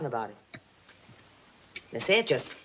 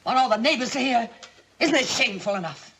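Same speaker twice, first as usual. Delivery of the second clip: sharp rebuke; 7 semitones higher than usual. Acted. Aunt silences shouting scene.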